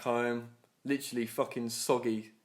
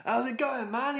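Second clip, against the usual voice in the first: much higher, gushing mock voice; enthusiastic boss; 11 semitones higher than usual. Big boss greeting him back from the rain, mock enthusiasm. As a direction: imitate an over-keen boss. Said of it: "he was all enthusiastic".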